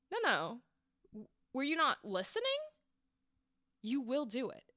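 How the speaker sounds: quiet, firm, no-nonsense correction; firm insistence. Shown as a demonstration. Coach demoing a villain who corrects a defiant victim, quietly firm. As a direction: insist quietly that they will obey. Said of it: "the intent changed".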